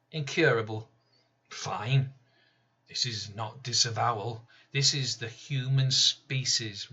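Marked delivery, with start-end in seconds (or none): none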